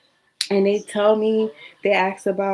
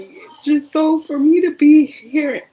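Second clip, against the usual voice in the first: real crying, pitched up and louder; tearful; 5 semitones higher, 7 dB louder than usual. Real, not acted. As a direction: voice rising into tears. Tearful account of past despair.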